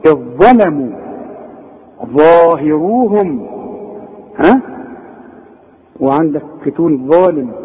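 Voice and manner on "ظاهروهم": ظاهروهم drawn out slowly with a heavy ظ; slow, emphatic ظاهروهم for the student; heavy dha. Shown as a demonstration. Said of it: "طلعها مفخمة".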